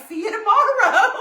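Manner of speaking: high, loud laughing "See you tomorrow"; mock guy's greeting. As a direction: mimic him while cracking up. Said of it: "this guy was like".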